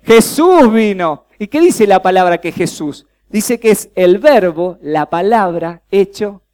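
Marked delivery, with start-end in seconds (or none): 0.0-1.2 loud, slow call for the crowd to answer; preacher calling out so the room answers together; call and response. Shown as a demonstration. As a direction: lead the congregation in a loud, slow, drawn-out reply. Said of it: "Vamos a decirlo todos juntos".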